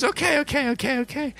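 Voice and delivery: high, quick repeated okays; NPC voice. Acted. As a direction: fluster through the okays in character. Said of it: "She goes".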